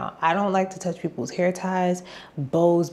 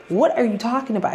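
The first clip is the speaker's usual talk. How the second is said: indignant disbelief; 6 semitones higher, 3 dB louder than usual. Retold or imitated. Indignant reply to Don.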